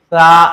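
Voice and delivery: سا held long and said loud; drawn out letter. Shown as a demonstration. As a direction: stretch سا out this long. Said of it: "اتنا کھینچیں گے". (سا کو اتنا کھینچ کر)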